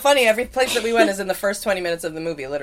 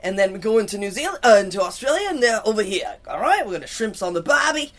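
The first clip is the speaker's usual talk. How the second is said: mock Aussie tour guide, lilting endings; Australian accent; 2 semitones higher than usual. Host guiding guys toward Australia, mock Australian accent. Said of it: "they're moving on to Australia".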